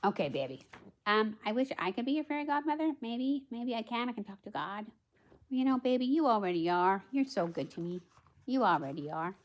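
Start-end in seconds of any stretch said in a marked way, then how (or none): none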